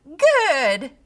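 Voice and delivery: high, loud crowing cackle; cackling good. A narrator's reading. Creature pleased with the pig, cackling. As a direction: cackle it with glee. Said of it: "cackled the small creature".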